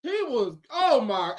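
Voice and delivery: normal talking voice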